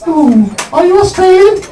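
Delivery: mock falsetto date, curious guess; very high put-on woman's voice, questioning; Tatiana. Date guesses the comedian's nationality, in mock falsetto. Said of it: "I can't read the accent".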